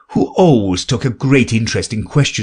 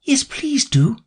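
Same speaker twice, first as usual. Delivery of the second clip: pleading. Acted. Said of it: "pleaded Alice".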